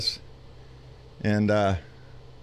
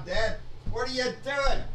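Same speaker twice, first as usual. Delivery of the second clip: higher, softer exasperated son voice; imitating son having a fit; 8 semitones higher, 5 dB quieter than usual. Son Travis scolding his dad for forgetting the light, mock exasperated. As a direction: mimic a fed-up son scolding. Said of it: "Travis would be having a fit".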